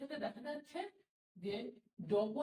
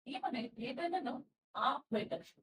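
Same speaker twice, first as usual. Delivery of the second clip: ordinary talk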